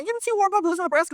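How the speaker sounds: high-pitched dismissive denial; disbelieving friend. Acted. Best friend on the phone doubts the story, incredulous. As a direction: shoot the claim down in a high voice.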